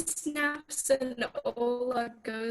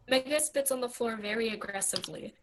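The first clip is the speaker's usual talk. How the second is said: ordinary talk, nothing marked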